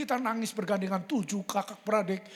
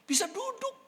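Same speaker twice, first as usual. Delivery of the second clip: much higher, astonished exclaim; amazed; 13 semitones higher than usual. Retold or imitated. Preacher marveling father could sit up, astonished. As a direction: marvel at the miracle.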